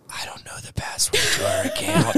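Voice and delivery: breathy whispered confession. Retold or imitated. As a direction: whisper it sheepishly. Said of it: "and I'm like".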